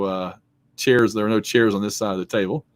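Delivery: ordinary talk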